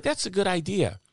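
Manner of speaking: high-pitched Wisconsin woman; thick Wisconsin accent. Retold or imitated. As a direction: play her in a high Wisconsin lilt. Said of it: "the thickest Wisconsin accent you ever heard".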